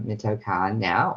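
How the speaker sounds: usual speaking voice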